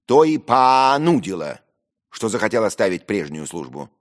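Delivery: loud, high, drawn-out retort; exaggerated drawl. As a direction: stretch it mockingly, barely keeping control. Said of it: "протянул я втрое больше, уже почти не владея собой".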